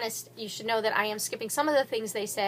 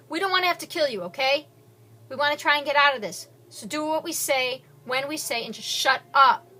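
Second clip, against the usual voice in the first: threatening; 5 semitones higher, 7 dB louder than usual. Acted. Gunman threatens all hostages.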